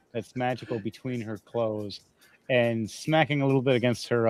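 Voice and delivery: ordinary delivery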